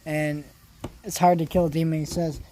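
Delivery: ordinary talk, nothing marked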